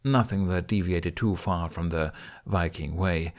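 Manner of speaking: plain reading voice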